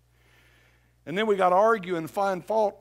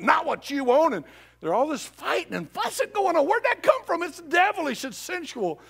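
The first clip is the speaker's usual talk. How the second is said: preaching peak; 10 semitones higher, 5 dB louder than usual. Real, not acted. Preaching climax.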